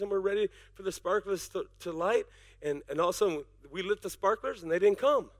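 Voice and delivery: soft, low personal story told to the congregation; hushed storytelling. Real, not acted. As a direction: drop into a low, confiding aside. Quiet aside story.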